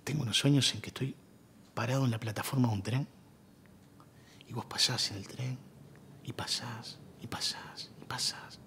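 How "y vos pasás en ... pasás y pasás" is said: slow, fading almost to a whisper; haunted hush. Acted. Man telling his old love his recurring dream, hushed. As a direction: let each repetition drift away, hushed.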